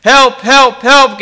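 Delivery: loud, high, panicked pleading; desperate cry for help. A narrator's reading. Damned man calling for help from hell, desperate. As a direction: call out in terror for rescue. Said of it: "gasps the man".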